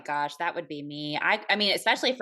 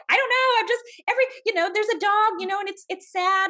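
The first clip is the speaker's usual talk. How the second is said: much higher and louder, hammed-up teary whine; mock teary whine; 11 semitones higher, 5 dB louder than usual. Wife answering husband, mock teary high whine. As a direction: play her past self helplessly weepy, pitched way up. Mimics her own teary self.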